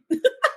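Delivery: short high-pitched laugh; quick amused laugh up high. Real, not acted. Laughs at own doubt.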